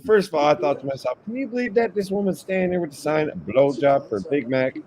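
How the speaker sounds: high, incredulous Southern retelling; Southern guy. Retold or imitated. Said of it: "he was a Southern guy too".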